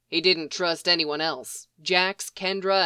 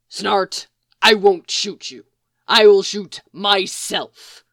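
exasperated outburst; 7 dB louder, slower than usual. A narrator's reading. Mick's exasperated threat.

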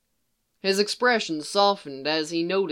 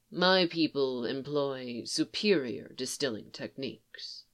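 low, soft and offhand; absent-minded; 3 semitones lower, 7 dB quieter than usual. Acted. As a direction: say it distractedly, eyes elsewhere.

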